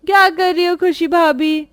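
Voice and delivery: high, teasing call; sly nanad. Acted. Kaya to Khushi in the kitchen, high and teasing. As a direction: poke at her sister-in-law slyly. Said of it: "और काया कहती है".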